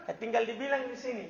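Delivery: ordinary talk, nothing marked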